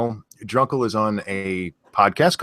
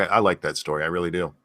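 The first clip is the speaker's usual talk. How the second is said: low, understated sarcasm; faintly sarcastic approval, trailing down; low-key and drily insincere; a bit sarcastic; 6 semitones lower, 4 dB quieter, faster than usual. Real, not acted. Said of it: "Sound a bit sarcastic".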